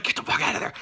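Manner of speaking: hushed, urgent whisper. Retold or imitated. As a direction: hiss it out under your breath. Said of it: "they're like".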